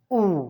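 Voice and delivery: ऊ as a long "oo"; Spanish U vowel. Shown as a demonstration. Teacher models last vowel, long isolated ऊ. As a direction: hold ऊ alone, as in mood. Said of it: "pronounce करने का तरीका है".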